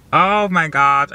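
opgetogen uitroep, hoger en luider; excited. Real, not acted. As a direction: burst out with joy. Excited about getting braces off.

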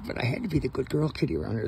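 cat voice. Pretend. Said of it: "She says".

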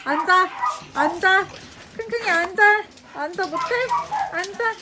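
loud high order to sit; firm dog command. Real, not acted. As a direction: bark the order.

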